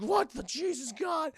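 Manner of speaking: high, slow, shocked squeak; high disbelieving character voice; tulip's shocked voice. Retold or imitated. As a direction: gasp it out in disbelief. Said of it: "She's like".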